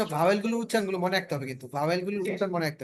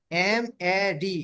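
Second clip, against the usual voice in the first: letters spelled out slowly one by one; slow spelling; much slower than usual. Shown as a demonstration. Teacher spelling a word slowly for beginners. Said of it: "teacher spells letters for class".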